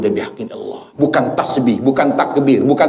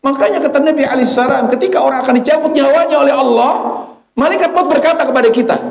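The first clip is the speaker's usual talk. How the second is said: high, sustained sermon proclamation; impassioned preaching; 14 semitones higher, 5 dB louder than usual. Real, not acted. Preacher telling how death's angel comes, impassioned. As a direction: announce it urgently up high.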